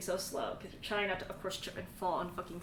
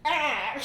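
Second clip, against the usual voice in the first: cry sound; 5 semitones higher, 8 dB louder than usual. Pretend. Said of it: "that exact sound of the action".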